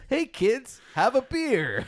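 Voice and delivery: high loud announcer joke; mock ad pitch. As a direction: pitch beer to kids cheekily. Joking mock ad to kids.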